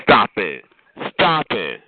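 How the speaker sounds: normal talking voice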